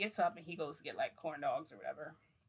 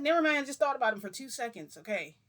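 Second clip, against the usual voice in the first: snapping; 6 semitones higher, 10 dB louder than usual. Retold or imitated. Snapping at Madara.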